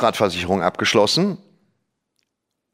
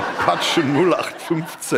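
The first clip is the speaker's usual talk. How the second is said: slow laughing repeat; 6 semitones higher, much slower than usual. Real, not acted. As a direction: drawl it out laughing.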